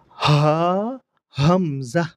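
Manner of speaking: হা and হামজা from the same throat point; ha and hamza. Shown as a demonstration. Teacher contrasts হা and হামজা, pressed. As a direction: contrast হা and হামজা from one spot. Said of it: "দোনোটা কিন্তু একই জায়গা থেকে উচ্চারণ হয়".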